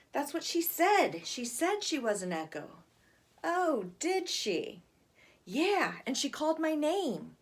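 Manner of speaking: put-on high, earnest kid voice; insistent little boy. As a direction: insist it really happened.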